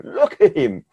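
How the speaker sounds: high, laughing repeat of the words; laughing with joy. Acted. Parent of the healed boy laughing in amazement, joyful laugh.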